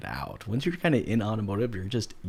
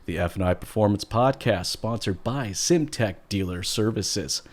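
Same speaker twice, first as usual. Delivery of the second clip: big, punchy radio host opening; best radio announcer delivery; 5 dB louder than usual. Shown as a demonstration. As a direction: open loud like a radio announcer, then settle into the sponsor read. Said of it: "That's my best radio announcer voice".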